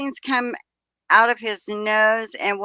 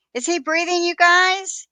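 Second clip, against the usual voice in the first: raised, higher call to the people nearby; 9 semitones higher, 4 dB louder than usual. Retold or imitated. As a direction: call across to the others. Dispatcher's question relayed to group.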